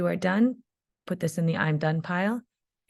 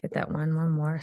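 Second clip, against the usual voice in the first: ordinary talk, nothing marked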